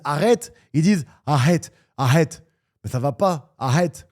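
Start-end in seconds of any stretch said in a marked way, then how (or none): 1.2-2.5 "arrête" as "ahète", Brazilian-style breathed H for R; loud Brazilian-accented "arrête", R turned into H; mock Brazilian accent. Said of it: "ils arrivent pas à dire le mot R". 3.6-4.1 "arrête" as "ahète", Brazilian H for R; loud Brazilian-accented "arrête", R breathed as H; put-on Brazilian accent on "arrête", louder; mock Brazilian accent. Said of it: "ils arrivent pas à dire le mot R".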